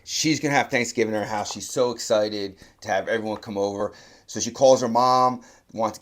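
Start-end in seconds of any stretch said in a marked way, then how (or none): none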